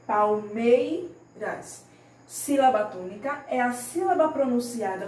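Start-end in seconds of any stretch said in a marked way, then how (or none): 0.1-1.8 "palmeiras" slowly, MEI loud and stretched; stressed-syllable demo. Shown as a demonstration. Teacher shows pupils the tonic syllable, stress on MEI. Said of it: "a sílaba tônica da palavra".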